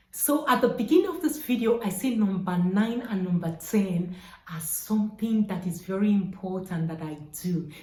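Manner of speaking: normal talking voice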